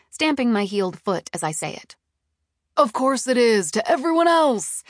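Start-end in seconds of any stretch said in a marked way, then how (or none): 2.7-4.8 high, loud defensive retort; heated, defensive reassurance; exasperated. A narrator's reading. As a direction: brush off the protest impatiently. Boss snaps back defensively.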